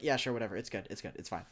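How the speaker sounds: low, quiet, shrugging it off; dismissive acceptance. Retold or imitated. Viewer retelling how they let the changelings' change pass, unbothered. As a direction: toss it away softly, trailing down.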